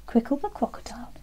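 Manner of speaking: ordinary delivery, nothing marked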